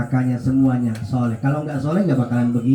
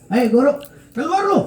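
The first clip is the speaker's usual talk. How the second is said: angry father; 10 semitones higher, faster than usual. Retold or imitated. Angry father confronts teacher.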